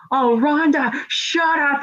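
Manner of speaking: high-pitched mocking retort; mock-yell it, pitched well up. Shotzi talking back to Ronda in the ring, mock yell. Acting out a wrestling confrontation.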